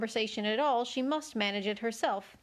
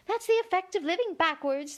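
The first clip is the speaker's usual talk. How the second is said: kindly Queen; 8 semitones higher than usual. A narrator's reading. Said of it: "the Queen said kindly".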